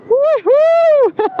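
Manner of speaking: high, loud victory whoop; joyful woohoo. Real, not acted. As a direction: cheer on arrival. Arriving at the viewpoint.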